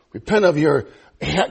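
normal talking voice

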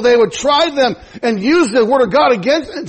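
usual speaking voice

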